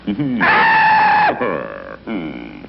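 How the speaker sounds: big villain laugh; booming evil laugh. Acted. Villain orders shipwreck.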